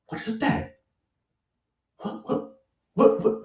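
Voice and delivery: hushed, high unvoiced whispers; breathy whispered repeats of what. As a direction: whisper it like you're spooked. Every time he hears the word.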